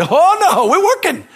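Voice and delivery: high, loud laughing refusal; insistent protest. Retold or imitated. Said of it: "I said".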